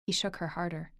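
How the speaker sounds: usual speaking voice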